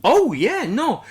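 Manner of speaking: usual speaking voice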